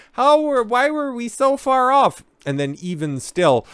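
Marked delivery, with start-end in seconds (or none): 0.1-2.2 much higher, sing-song oopsie voice; mock pollster. Mocking pollsters who missed Trump, much higher and sing-song. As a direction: play a clueless pollster caught out.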